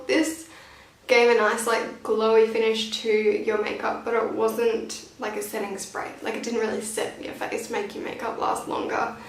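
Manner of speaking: ordinary talk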